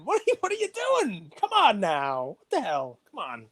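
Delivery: high, incredulous outburst; exasperated. Real, not acted. To sticker graders, high and exasperated. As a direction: play it as disbelief at the sticker. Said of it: "this gets me angry".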